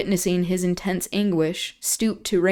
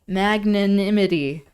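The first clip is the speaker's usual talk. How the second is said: ordinary delivery, nothing marked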